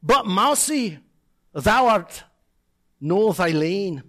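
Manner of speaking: slow Scots poetry recital; Scottish accent. Acted. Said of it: "in my best Scottish accent".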